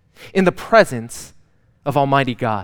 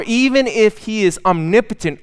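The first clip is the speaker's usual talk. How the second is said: ordinary talk, nothing marked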